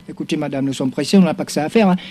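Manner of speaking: deep, soft, fed-up official voice; a gendarme losing patience, low and quiet; low impatient gendarme. Acted. Gendarme impatient, voix grave. (gendarme impatient, voix grave et basse)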